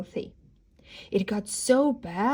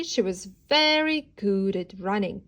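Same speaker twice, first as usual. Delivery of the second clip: ordinary delivery, nothing marked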